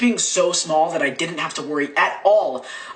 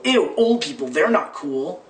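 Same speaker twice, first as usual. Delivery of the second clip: mock disgust; 2 semitones higher, 3 dB louder, slower than usual. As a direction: recoil in exaggerated teenage disgust. Mocking teens' disdain for old people.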